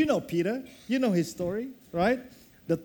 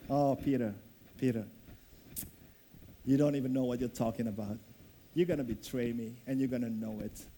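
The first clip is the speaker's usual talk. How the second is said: soft, low and tender, gently correcting; loving; 9 semitones lower, 6 dB quieter, faster than usual. Acted. Jesus gently tells Peter he will betray him, soft and loving. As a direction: hush it down like telling a friend a hard truth kindly. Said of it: "in a loving way".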